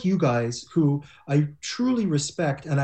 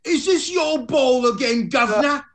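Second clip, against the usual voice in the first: high mock Cockney British; hammed-up British accent, higher; 7 semitones higher, 4 dB louder than usual. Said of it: "and he said".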